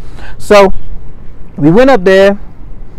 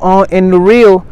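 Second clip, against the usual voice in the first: usual speaking voice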